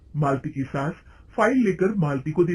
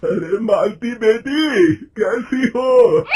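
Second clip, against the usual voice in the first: put-on slow, loud, high call of an old man; old clerk calling out to Malti in the bazaar; 6 semitones higher, 9 dB louder, much slower than usual. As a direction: hail her across the market, drawn out. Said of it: "उसे आवाज देता है".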